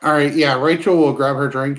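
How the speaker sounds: ordinary talk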